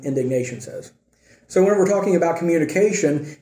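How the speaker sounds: ordinary talk, nothing marked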